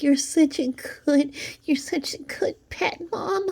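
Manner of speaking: high, slow mocking baby talk; cooing at a pet, dripping sarcasm; mock pet-owner coo. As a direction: sneer it in a syrupy pet-owner voice. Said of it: "and be like".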